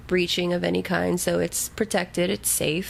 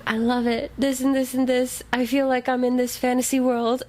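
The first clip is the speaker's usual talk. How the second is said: higher-pitched retold gushing; gushing message; 7 semitones higher than usual. Reader messaging co-host about a fantasy world, gushing. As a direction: gush it like an excited fan's text. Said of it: "And I would message you".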